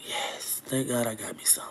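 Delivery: hushed, breathy confession; whisper. Real, not acted. Confiding a private detail to her viewers in a whisper. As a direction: drop to a secret whisper.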